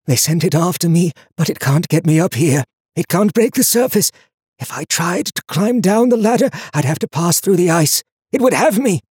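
high, strained hoarse voice; hunted man's strangled urgency; frightened whisper. A narrator's reading. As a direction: urgently explain the danger, half whispering. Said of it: "Lucas whispered".

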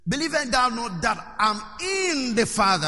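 preacher stretching "in" for emphasis; stress on "in". Shown as a demonstration. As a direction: lean hard on the word "in". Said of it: "I want you to see the emphasis".

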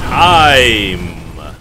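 loud sharp call; calling time. Real, not acted. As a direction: shout it to stop the clock. Calling end of timer.

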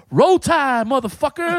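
high, loud mock battle cry; hammed-up team chant as an insult; defiant cry, retold. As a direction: imitate an irate mother yelling defiance.

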